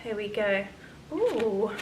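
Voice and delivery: ordinary talk, nothing marked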